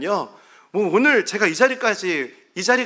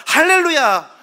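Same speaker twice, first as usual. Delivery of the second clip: louder, higher jubilant praise; joyful hallelujah; 5 semitones higher, 5 dB louder than usual. Retold or imitated. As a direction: burst out in thanks. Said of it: "막 차에서 혼자 그러고 왔거든요".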